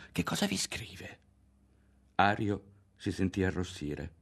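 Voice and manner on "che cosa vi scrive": an eager whispered question. A narrator's reading. Lidia to Ario, whispering her curious question. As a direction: whisper it, hungry for the secret. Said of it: "sussurrò".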